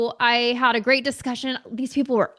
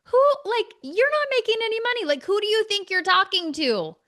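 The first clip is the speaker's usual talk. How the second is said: indignant outburst, pitched much higher; high, offended mock rant; ego flaring up; 8 semitones higher than usual. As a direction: let the bruised ego explode in disbelief. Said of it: "my ego for sure flared up".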